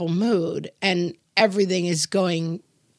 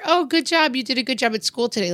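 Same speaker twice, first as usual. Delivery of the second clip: forced cheery praise; 7 semitones higher than usual. Retold or imitated. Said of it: "no matter".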